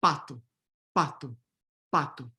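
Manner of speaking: pato with final o reduced; unstressed final o in pato; Portuguese pato. Shown as a demonstration. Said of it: "the unstressed final O".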